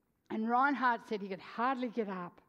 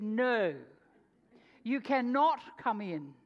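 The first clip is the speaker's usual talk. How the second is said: firm refusal; 6 dB louder, slower than usual. Retold or imitated. Large woman refusing at door.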